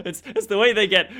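laughing through the words; words breaking into laughter. Real, not acted. Laughing mid-sentence at the joke.

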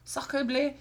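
hammed-up French accent; mock French, high and loud; french chef. Mock French replies to sorry joke.

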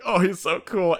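lightly voiced starstruck Kobe; mock fanboy. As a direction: gush like an admiring fan. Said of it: "Kobe looking at the paper going".

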